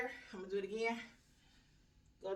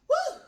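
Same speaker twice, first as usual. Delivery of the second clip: very high loud whoop; excited whoop; 5 dB louder than usual. Real, not acted. After the final eight counts, a high excited whoop. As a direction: let out a victory woo. Finishing the set.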